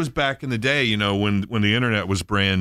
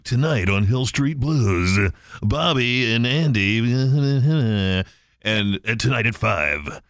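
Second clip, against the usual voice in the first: slow announcer read; a light TV promo voice; slower than usual. Retold or imitated. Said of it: "That's where you go".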